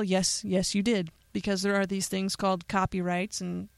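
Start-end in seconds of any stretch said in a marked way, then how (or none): none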